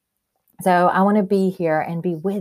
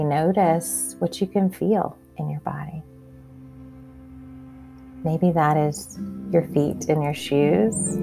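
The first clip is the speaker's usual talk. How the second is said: calm grounding exercise. Acted. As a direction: guide them gently into their senses. Leads a grounding meditation.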